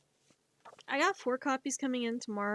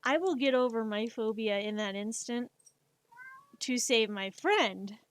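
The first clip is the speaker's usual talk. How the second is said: usual speaking voice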